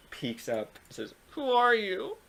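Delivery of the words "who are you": put-on teary girl voice.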